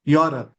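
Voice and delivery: "Europe" as a falling YAW-rup; correct pronunciation. Shown as a demonstration. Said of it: "Y O wala".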